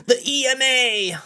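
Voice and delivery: high squealing yell; mock shriek. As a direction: shriek it mockingly. Mocking shout at chatter.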